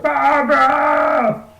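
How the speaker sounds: high, slow, drawn-out shout of "Barbara!"; anguished shout. Retold or imitated. Commissioner screams for his daughter, long wailing shout. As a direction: stretch the name out in a long wailing yell. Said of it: "He's like".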